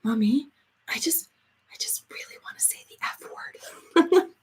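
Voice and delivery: put-on secretive little-girl whisper, rising at the end; child's whisper. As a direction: whisper it like a kid confessing a secret. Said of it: "she'd say".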